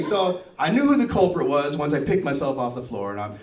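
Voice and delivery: ordinary delivery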